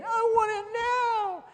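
put-on high, drawn-out childish whine; little kid tantrum. Preacher mimics a kid throwing a fit, whining. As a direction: wail it like a bratty child. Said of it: "throw a fit like a little kid".

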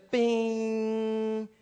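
long, dead-steady held bing; in-tune string ring. Shown as a demonstration. Showing the piano once tuned, steady bing. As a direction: hold one even note without wavering. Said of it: "but it was".

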